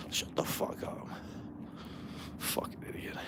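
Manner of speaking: very soft, voiceless cursing; whispered contempt. Real, not acted. Venting about the performer who refused the tip, whispered insult. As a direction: curse him under your breath. Muttering insults after the mimicry.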